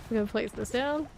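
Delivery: normal talking voice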